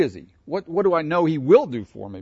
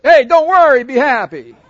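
loud, high, cheery and oblivious; mock the careless cheer of a hit man; sing-song mock optimism after a disaster; mock cheerful; 10 semitones higher, 13 dB louder than usual. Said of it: "You just got run over by a truck".

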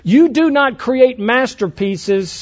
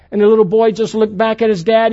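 ordinary delivery

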